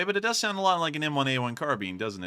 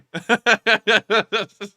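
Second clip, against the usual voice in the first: loud laugh; 7 semitones higher, 7 dB louder than usual. Real, not acted. Laughing after robot bit.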